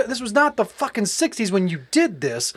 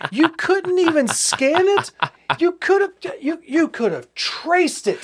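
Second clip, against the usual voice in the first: higher, slow, incredulous rant; exasperated disbelief; 8 semitones higher, slower than usual. Real, not acted. Mocking the thieves' bad fake, in exasperated disbelief. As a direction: drag out the disbelief, voice climbing.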